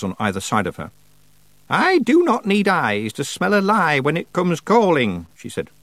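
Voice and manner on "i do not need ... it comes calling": high, slow, ominous witch voice; stern witch. Acted. Witch warns lying Feegles, stern. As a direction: draw out the warning, pitched high.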